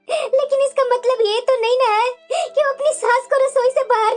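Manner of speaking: aggrieved lament with sobbing breaths; tearful complaint. Acted. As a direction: plead your case to your husband through tears. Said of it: "तुम्हारी आंखों में आंसू क्यों है". (सिसकते हुए दुखी स्वर में)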